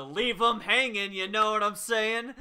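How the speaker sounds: loud joking tease; playful loud. Real, not acted. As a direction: belt the punchline out. Joking about leaving survivor hanging.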